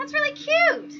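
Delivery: very high, loud squeal of delight; gushing that's really cute. Real, not acted. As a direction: squeal like you just saw a puppy. Cooing over a photo.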